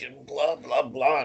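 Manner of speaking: ordinary talk, nothing marked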